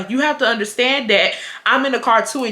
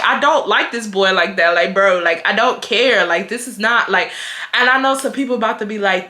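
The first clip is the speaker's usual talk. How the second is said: ordinary talk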